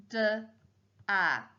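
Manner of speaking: "d, a" as two isolated sounds; sounding out letters. Shown as a demonstration. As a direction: say "d, a" by sound, pause between. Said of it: "And I'll spell it".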